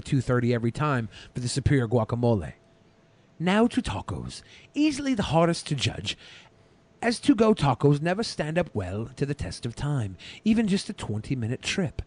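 measured, deliberate review narration; a hint of a British accent in the read; food review voiceover. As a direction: read it like a taste-test video host. Reads his food review voiceover.

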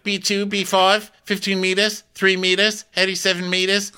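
high, loud rattled calls; mock calling distances. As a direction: call out random numbers, panicked. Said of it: "She's like".

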